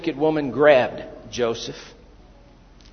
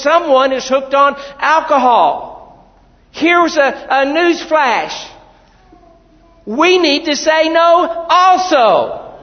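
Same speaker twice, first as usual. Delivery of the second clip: full-force preaching; 11 semitones higher, 9 dB louder than usual. Real, not acted. Preacher shouting to the congregation at full force. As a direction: hammer the message home.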